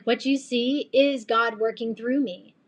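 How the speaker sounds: normal talking voice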